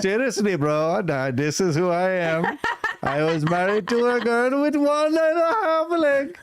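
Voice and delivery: very high, excitable mock Indian voice. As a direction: push the Indian accent up high, breaking into laughs. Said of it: "worst fucking Indian accent".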